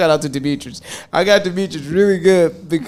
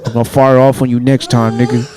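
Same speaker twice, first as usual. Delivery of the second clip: lower, angry voicemail rant; 5 semitones lower than usual. Retold or imitated. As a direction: threaten the caller. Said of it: "I was like".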